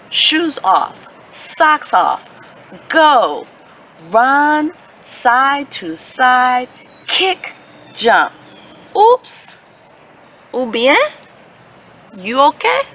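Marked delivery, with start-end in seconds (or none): none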